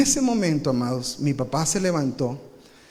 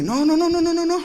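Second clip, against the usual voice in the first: high, rapid no's; pleading kids; 12 semitones higher, 4 dB louder than usual. Retold or imitated. Kids begging their dad to stop, high and rapid. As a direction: beg him to stop in a high voice.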